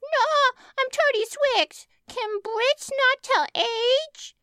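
put-on high squeaky toddler voice; kid voice. A narrator's reading. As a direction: pitch way up, slow and childish. Toddler character voice.

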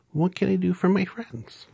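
very high, tiny squeak; squeaky mouse voice. A narrator's reading. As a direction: squeak like a little mouse queen. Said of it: "in her squeaky little voice".

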